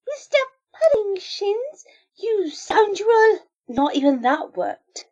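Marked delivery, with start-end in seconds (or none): 0.1-3.5 slow hammy puppet voice; high, slow melodramatic puppet voice; puppet show voice. Retold or imitated. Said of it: "a saucy puppet show".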